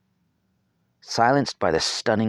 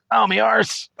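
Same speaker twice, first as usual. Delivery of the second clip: pained yelp; 5 dB louder than usual. A narrator's reading. Boy landing on tailbone.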